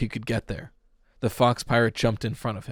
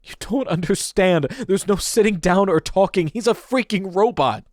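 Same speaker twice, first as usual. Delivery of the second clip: desperate cry; 9 semitones higher, 5 dB louder than usual. Acted. Pete desperate with teacher.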